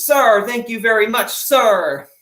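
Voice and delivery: put-on a young sailor answering the admiral, loud and eager; eager young seaman. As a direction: snap back politely to a superior.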